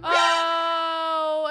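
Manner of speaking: loud sudden exclamation; startled oh. Real, not acted. Owner sees Athena flirt with her, startled loud oh. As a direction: caught off guard, burst out.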